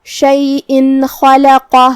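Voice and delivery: شیئن chanted slowly with a clear final n; clear tanween n. Shown as a demonstration. Teacher showing izhar to learners, شیئن with clear n, slowly chanted. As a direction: end شیئن on a plain n, then slow خلقہ. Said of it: "اسے نون ساکن پڑھیں گے اور بغیر غنہ".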